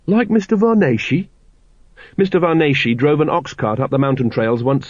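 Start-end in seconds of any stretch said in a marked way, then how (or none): 0.1-1.3 higher, startled question; a lightly voiced surprised lad; astonished boy. A narrator's reading. Carrot asking his father in surprise.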